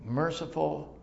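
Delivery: ordinary talk, nothing marked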